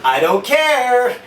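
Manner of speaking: loud, slow, high yell back; wife yelling back through the door; defiant shout. Retold or imitated. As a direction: shout each word defiantly. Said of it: "wife yelling back through door".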